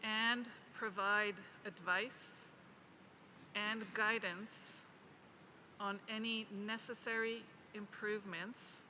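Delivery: very slow, phrase by phrase, long pauses between chunks; deliberate read-out, punctuation spoken aloud; slow dictation. Real, not acted. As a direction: dictate it slowly so it can be written down. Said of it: "I will read it as slowly as I can".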